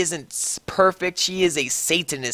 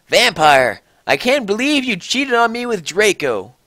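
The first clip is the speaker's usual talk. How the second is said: angry shout; 7 semitones higher, 3 dB louder than usual. A narrator's reading. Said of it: "I shouted at him".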